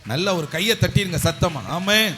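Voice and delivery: loud, punchy exhortation; rally the crowd at volume; loud rallying call. Real, not acted. Preacher urging the congregation to clap, loud rallying call.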